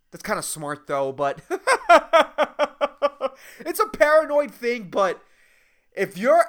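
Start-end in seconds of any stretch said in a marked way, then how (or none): 1.4-3.3 high staccato giggle; laughing. Real, not acted. Comic laughing at own paranoid remark, high giggle. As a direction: burst into a run of rapid chuckles.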